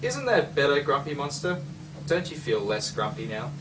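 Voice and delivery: plain reading voice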